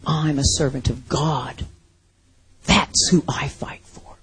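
soft whispered declaration; hushed vow. Retold or imitated. Preacher voices what Israel should have said, in a whisper. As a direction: say it quietly, like a private vow.

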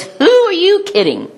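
high, mocking disbelief; Abraham scoffing. Abraham scoffing at God's promise, mock high voice. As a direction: scoff it like an old man told the impossible. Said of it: "He says".

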